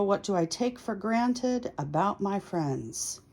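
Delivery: normal talking voice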